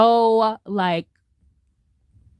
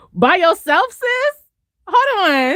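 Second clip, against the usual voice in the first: high-pitched, loud incredulous retort; hammed-up disbelief; sassy mock challenge; 10 semitones higher, 3 dB louder, slower than usual. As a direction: challenge her in a squeaky, disbelieving voice. Acts out challenging the heroine.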